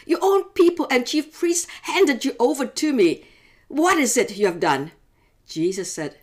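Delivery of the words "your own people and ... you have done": pressing governor. Acted. Pilate presses Jesus.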